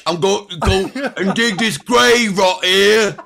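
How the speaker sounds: loud, high, slow bad British accent; mock British accent. Host mocking guest's Cumberbatch impression, British accent. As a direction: ham a yokel-ish British accent, laughing. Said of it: "Did you do a British accent".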